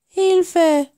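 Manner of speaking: high, drawn-out plea for help; frightened little voice. A narrator's reading. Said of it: "schluchzte ein leises, ängstliches Stimmchen".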